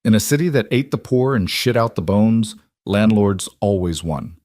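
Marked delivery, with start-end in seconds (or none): none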